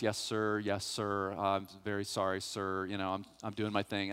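soft, humble sir-ing to the judge; polite and meek. Retold or imitated. Teen in court being extra polite to the judge, soft and meek. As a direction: play the good boy, voice low and small. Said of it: "I'm being nice".

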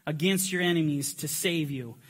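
loud, high rallying proclamation; preacher rousing the room, loud and high; battle cry reading. Real, not acted. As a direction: call the congregation to battle. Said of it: "We are gonna do our battle cry".

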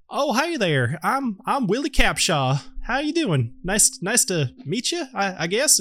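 high, hesitant sleepy newcomer; Willie Capshaw. Acted. Sleepy lute player introduces herself, high tentative voice. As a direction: pitch it up and greet uncertainly. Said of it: "she introduces herself. She says".

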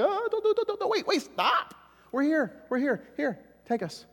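very high, urgent cries to stop; frantic Paul and Silas. Retold or imitated. Paul and Silas stopping the jailer, frantic and high. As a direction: call out in panic to save him. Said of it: "Paul and Silas say".

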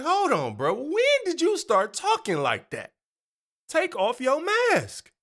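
high-pitched, exasperated disbelief; mock incredulous protest. Congregant challenging a pastor's fake voice, incredulous and high. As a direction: pitch way up, calling out the phony.

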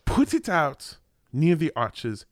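put-on deliberate French accent with a German tinge; botched French accent. Frenchman scolding a tourist near the arches, botched French accent. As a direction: imitate a stern Frenchman, halting then emphatic. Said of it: "more German right now than French".

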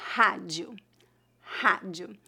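"Rádio" low, English H at the start, open A. Shown as a demonstration. Teacher showing learners initial R, R as H. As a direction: say "rádio" as HA-jee-oo. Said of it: "como a letra H do inglês".

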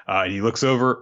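ordinary delivery

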